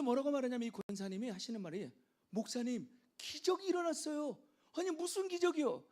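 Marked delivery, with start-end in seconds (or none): 2.3-4.4 high-pitched, excited announcement; retold excitement. Deaconess runs to the pastor with a miracle, excited. 4.7-5.8 high-pitched, puzzled question; retold surprise. Pastor answers the deaconess's miracle news, surprised.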